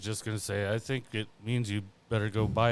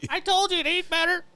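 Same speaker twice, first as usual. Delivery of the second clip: much higher mocking bird voice, louder; mocking parrot; 7 dB louder than usual. Parrot mocking its owner on his deathbed, high taunt. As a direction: taunt him like a parrot would. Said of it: "your fucking parrot's mocking you".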